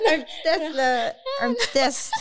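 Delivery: high-pitched, giggly pleading; playful coaxing. Real, not acted. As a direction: coax her playfully in a high voice. Insiste en riant pour une démonstration. (insistance enjouée, voix aiguë)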